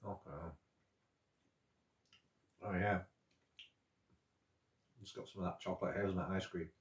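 savoring between spoonfuls; talking while eating. Real, not acted. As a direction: mouth partly full. Seen 0: spoon held at mouth, chewing and savoring faces throughout.

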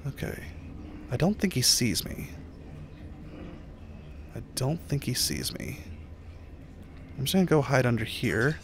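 quiet hushed hiding voice. Real, not acted. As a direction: keep it down so he can't hear. Hiding from the monster.

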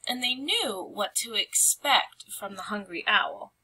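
ordinary delivery, nothing marked

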